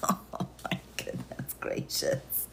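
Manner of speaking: slow, breathy whisper to herself; whispered exclamation. Real, not acted. Host whispering to herself after the mess. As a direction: whisper it under her breath.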